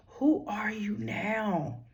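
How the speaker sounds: slow breathy whisper of the question; hushed whisper. Acted. Speaker to grieving listeners, a slow hushed whisper. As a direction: whisper it slowly, like grief speaking in your ear.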